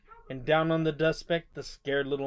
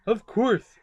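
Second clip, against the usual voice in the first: louder, firm promise; firm reassurance; 5 semitones higher, 6 dB louder than usual. A narrator's reading. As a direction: reassure the mayor. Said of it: "answered Horton".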